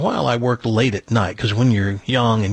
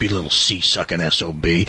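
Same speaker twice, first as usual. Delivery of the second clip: much lower, booming buddy-buddy baritone; commanding voice; 9 semitones lower than usual. Retold or imitated. Newsman ordering the teen intern to fetch cigarettes, deep commanding voice. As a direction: lay on the deep boss charm. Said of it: "in that commanding voice".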